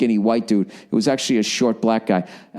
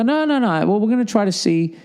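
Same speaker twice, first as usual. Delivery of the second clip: higher-pitched, lightly imitated reassurance; placating staff; 8 semitones higher than usual. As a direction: soothe an objecting customer, mock-official. Staff placate the ejected fan.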